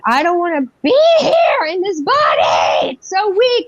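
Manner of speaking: high, wailing reenacted scream; hysterical screaming. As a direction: act out a panicked outburst, pitch shooting up. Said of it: "I'm screaming and I'm hysterical".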